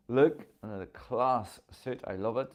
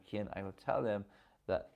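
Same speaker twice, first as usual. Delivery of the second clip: ordinary delivery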